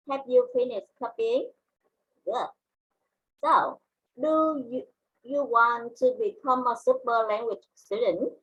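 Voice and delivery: usual speaking voice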